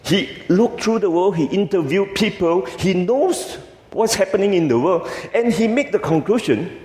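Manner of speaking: high, emphatic build-up. Acted. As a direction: drive it up with emphasis. Emphatic build-up about journalist.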